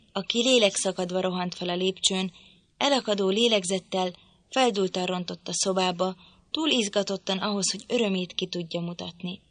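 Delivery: ordinary delivery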